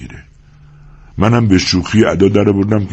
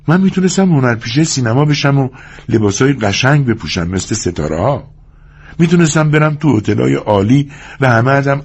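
higher-pitched woman's voice, carried away; picture the glamour you missed, pitched up; dreamy, boastful wife; 7 semitones higher than usual. Acted. Curley's wife imagining film stardom, carried away.

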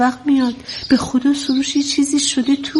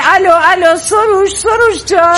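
higher, louder anxious call; alarmed calling; 5 semitones higher, 4 dB louder than usual. Acted. Mother calling her son as the line cuts, alarmed. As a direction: call urgently into a dead phone line.

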